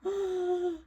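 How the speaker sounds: high gasp into look; gasping reveal. Real, not acted. Big reveal of the drawing.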